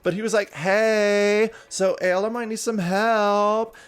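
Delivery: high, loud, bubbly woman's voice; high-pitched gushing character; excited relative. Acted. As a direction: chatter away in a high, giddy voice.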